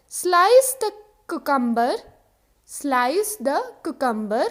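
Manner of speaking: slice the cucumber said very slowly; slow model sentence. Shown as a demonstration. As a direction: drill it slowly twice. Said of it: "next is खीरा काट दो". (धीरे-धीरे बोलें)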